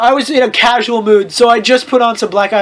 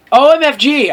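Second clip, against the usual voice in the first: mild mock yell; 5 semitones higher, 4 dB louder than usual. A narrator's reading. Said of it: "I yielded as I jumped up".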